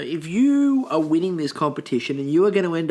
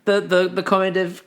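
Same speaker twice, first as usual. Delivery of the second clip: usual speaking voice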